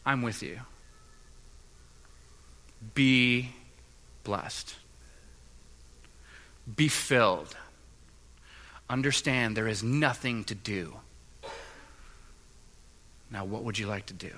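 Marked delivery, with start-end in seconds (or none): 0.0-11.0 Jesus speaking tenderly. Retold or imitated. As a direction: speak gently as comfort after the yelling. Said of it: "Jesus enters in and just says".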